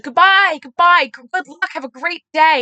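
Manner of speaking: loud, high, gushing goodbye; effusive farewell. Retold or imitated. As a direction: imitate an over-friendly send-off. Retelling a stranger's enthusiastic farewell.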